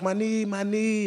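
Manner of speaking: high, drawn-out wail of pain; mock crying. Injured date crying to her partner, high mock crying. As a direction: imitate a tearful cry, slowly. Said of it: "and she's crying".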